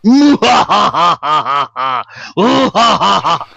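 unhinged villain cackle; maniacal laugh. Pretend. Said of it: "I had to do the maniacal laugh".

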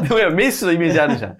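normal talking voice

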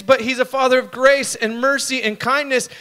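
normal talking voice

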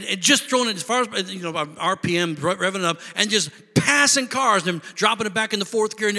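ordinary talk, nothing marked

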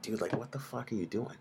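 a hushed, exasperated aside to a bettor; mock disbelief. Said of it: "you're like".

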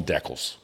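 DECALS said as DECK-uls; short first syllable in DECALS, rhyming with heckles; Canadian pronunciation. Shown as a demonstration. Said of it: "if you're in Canada, they call 'em decals".

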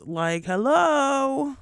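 high, slow drawn-out hello; exaggerated hello. Real, not acted. Calling on friends to check on her, drawn-out high. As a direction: stretch it out like nobody's listening.

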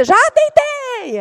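high, drawn-out victory call; long, high-pitched playful cry; triumphant calling out. Retold or imitated. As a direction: sing out that you got there first. Racing to bed, calling out first.

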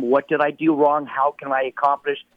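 ordinary talk, nothing marked

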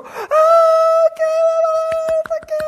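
put-on very high, loud, gushing coo over a toddler; a lobby full of staff swooning over the little boy in his frog raincoat; cooing crowd. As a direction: squeal his name like adoring fans. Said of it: "hear all these people that go".

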